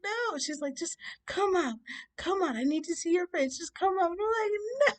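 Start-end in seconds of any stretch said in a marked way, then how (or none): 0.0-0.4 high mock-crying no; tearful refusal. Crying refusal in retold breakdown. 0.7-4.1 high, insistent coaxing lady; coaxing woman. Retold or imitated.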